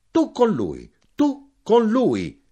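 insistent shout climbing very high. A narrator's reading. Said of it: "gridai io".

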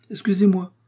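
high, urgent little excuse; hurried apology. A narrator's reading. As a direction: blurt an apology in a high pitch. D'Artagnan apologises from under cloak. (excuse précipitée, voix aiguë)

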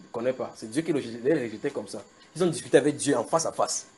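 ordinary talk

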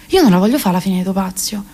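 ordinary talk

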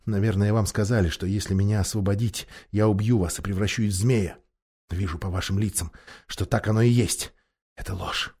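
low, soft, controlled reasoning; trying to stay calm. A narrator's reading. Captive knight reasoning with his watchers, deliberately calm. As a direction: reason quietly, keeping desperation in check. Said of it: "стараясь говорить спокойно".

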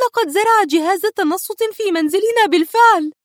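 alarmed, pressing on; wife warns her husband over the phone; worried wife. A narrator's reading. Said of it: "تابعت ليليان".

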